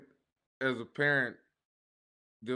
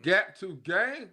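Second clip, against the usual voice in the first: loud, slow catchphrase; 6 semitones higher, 6 dB louder, much slower than usual. Acted. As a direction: call it out slowly and loud.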